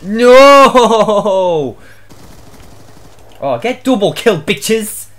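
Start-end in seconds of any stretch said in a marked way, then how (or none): 0.0-1.8 shouted no. Real, not acted. Gamer loses a fight.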